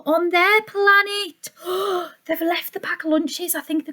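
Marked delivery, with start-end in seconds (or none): 1.5-2.0 a quick mock-shocked gasp. A narrator's reading. Storyteller gasping at the lunches left at home. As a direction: gasp as if you just noticed.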